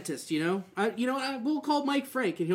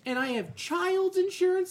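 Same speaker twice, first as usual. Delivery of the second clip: incredulous; 11 semitones higher, 3 dB louder than usual. Retold or imitated. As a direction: stretch it out way up, outraged. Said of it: "I'm like".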